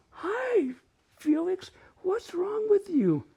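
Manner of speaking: high, slow pet-talk voice; talking to animals. Retold or imitated. Kid talking to the cat, high and sweetly concerned. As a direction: coo a question to a cat. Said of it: "I talk to animals".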